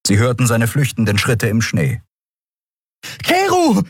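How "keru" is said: very high call after a fleeing friend; calling after him. Acted. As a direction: shout his name after him. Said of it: "rief Endriel ihm nach".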